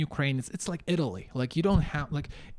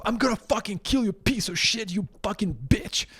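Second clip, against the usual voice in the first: higher, fast furious threats; threatening yell; 5 semitones higher, 3 dB louder than usual. Retold or imitated. Customer threatens store staff over missing six hundred, furious yell. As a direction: rage at them till they pay.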